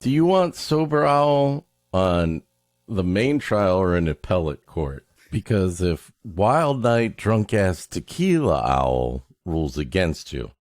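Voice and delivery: normal talking voice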